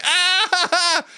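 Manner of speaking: high drawn-out "aah" breaking into laughter; mock falling scream. Imitates falling in a dream.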